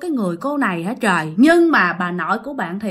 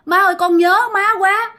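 crying plea; 6 semitones higher than usual. Acted. Woman clinging to grandma's legs, begging through tears. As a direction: wail it like a grieving daughter-in-law. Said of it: "Khóc quá trời".

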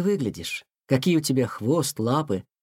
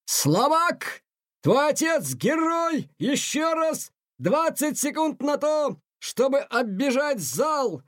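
high, slow shouted commands; drill shout; 10 semitones higher, slower than usual. A narrator's reading. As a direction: hammer the cadet with orders. Colonel drilling exhausted cadet.

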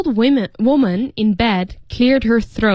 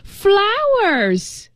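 high, sudden shriek of delight; old woman screaming; 13 semitones higher than usual. Acted. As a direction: cry it out like a thrilled old lady. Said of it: "screamed the old woman".

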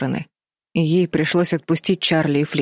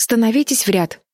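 mild command; 6 semitones higher than usual. A narrator's reading. Betty orders the others into line, lightly commanding. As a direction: give a brisk order, pitch raised. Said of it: "велела Бетти".

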